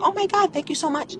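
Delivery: a touch of a grateful bank clerk; banker gushing. Retold or imitated. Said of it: "they're like".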